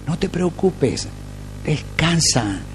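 slow, hushed comfort to a worried flock; soft reassurance. Real, not acted. Pastor soothing the church to rest, softly. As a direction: let them breathe out, gently.

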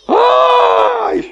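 un "¡ay!" agudo y gritado; grito de susto. Acted. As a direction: exclama asustado, muy agudo. Actúa el grito del siervo. (grito agudo de espanto)

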